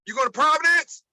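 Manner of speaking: imitating a coach, high loud calling out; coach's greeting. Coach greeting a young player as he arrives, imitated high calling out. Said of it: "he always would say".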